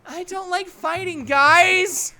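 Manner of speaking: high-pitched plea rising to loud; upset Sayori. Acted. Sayori begging friends to stop fighting, upset. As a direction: cry out on the last word.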